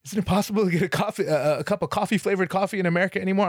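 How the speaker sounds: fast, high indignant comic rant; Dennis Leary rant. Retold or imitated. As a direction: rattle it off like a ranting comic. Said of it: "Dennis Leary talking about coffee".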